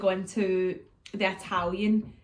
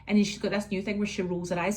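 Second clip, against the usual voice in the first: usual speaking voice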